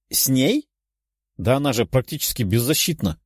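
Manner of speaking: incredulous, rising question; surprised. A narrator's reading. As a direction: voice a princess taken aback. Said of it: "удивилась принцесса".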